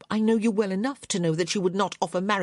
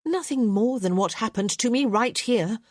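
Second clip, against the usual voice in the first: higher, a touch louder cutting reply; haughty young lady striking back; arrogant retort; 6 semitones higher, 4 dB louder than usual. A narrator's reading. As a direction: aim the line to wound. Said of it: "perfectly arrogant arch".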